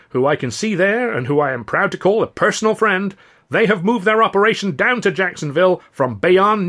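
high, loud Southern politician; showman's oratory; booming mayor. Acted. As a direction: play to the crowd grandly. Mayor speech voice held.

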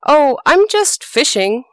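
a sly rabbit calling up from below. Acted. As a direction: sing it up out of the well, all innocence. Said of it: "he called up".

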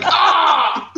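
high, laughing fake yell of frustration; mock frustrated cry. Reader hooked on the series, mock frustrated cry. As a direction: cry out in playful exasperation.

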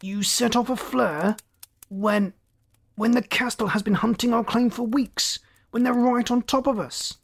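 high, strained croak of outrage; an appalled captain croaking out an accusation. A narrator's reading. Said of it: "The captain croaked".

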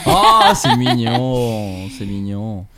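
drawn-out, melting "aww"; tender cooing. Real, not acted. Host touched by the story of a calming dog, cooing. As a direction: coo it slowly, then let it soften.

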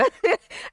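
quick breathy laugh; high giggle. Real, not acted. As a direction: giggle before the story. Laughing before telling dad story.

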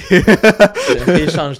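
slow words pushed out through loud laughter; real laugh breaking over the words. Real, not acted. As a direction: laugh your way through the line. Laughing at the joke together.

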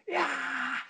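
high mock roar from the stands; crowd cheer. Millwall fans celebrating the winning penalty, high mock cheer. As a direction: imitate the fans going wild.

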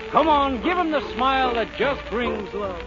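ordinary delivery

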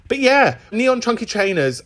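normal talking voice

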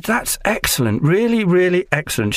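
hammed-up impressed casting director; gushing casting director. Actor imitating the casting director gushing over his tune.

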